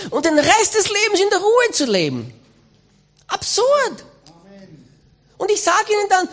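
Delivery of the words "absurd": scoffing. Real, not acted. Preacher scoffing at lazy Christians.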